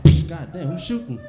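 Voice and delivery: loud puffed gunshot then startled cry; gunshot noise. Acted. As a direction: fire a mouth gunshot, then panic. Gunshot sound then startled neighbour.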